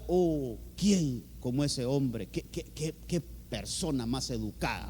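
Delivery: low, hushed imitation of admirers; mock murmur of admiration, slow to start; admiring public opinion. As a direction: voice the onlookers' praise, softly and low. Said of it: "la opinión pública decía eso".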